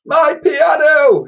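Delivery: high, loud, drawn-out wail; slow, high-pitched outraged cry; anguished cry. A narrator's reading. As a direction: cry out in dismay from inside the wreckage. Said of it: "she cried from inside".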